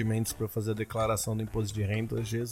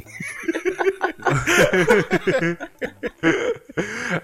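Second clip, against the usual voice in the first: laugh; 10 semitones higher, 5 dB louder than usual. Real, not acted. Laughing at the reply.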